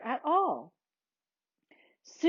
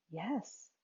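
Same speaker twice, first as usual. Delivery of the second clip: very soft whispered answer; 14 dB quieter than usual. A narrator's reading. Mary answering Mom, whispering. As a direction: answer in a tiny hushed voice. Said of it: "whispered Mary".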